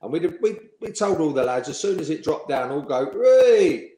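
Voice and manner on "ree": loud, high drawn-out cheer; high, long mocking "whey" of a cheer; retold group cheer. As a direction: cheer like a roomful of teammates. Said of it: "we all cheered".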